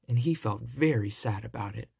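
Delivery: ordinary delivery, nothing marked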